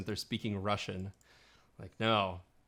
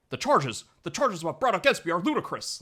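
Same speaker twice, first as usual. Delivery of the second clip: higher, louder theatrical movie-villain impression; Kylo Ren; 8 semitones higher, 6 dB louder than usual. Podcaster mimicking the actor's line from the film, hammed-up villain impression. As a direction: declaim it indignantly, imitating a film actor. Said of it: "he sounded a lot like Kylo Ren".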